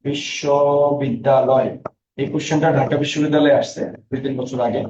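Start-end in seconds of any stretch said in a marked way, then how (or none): 0.0-1.7 slow বিশ্ববিদ্যালয় with syllables drawn apart; teacher sounding out a word slowly; sounded out slowly. Shown as a demonstration. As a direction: stretch বিশ্ববিদ্যালয় for the class. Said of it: "কয়টি অক্ষর আছে?".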